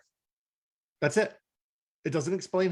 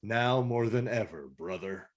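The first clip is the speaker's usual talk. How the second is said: lower, softer solemn leader voice; mock Primal; 4 semitones lower, 5 dB quieter than usual. Mimicking Primal's solemn reply, low and grave. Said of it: "And Primal's like".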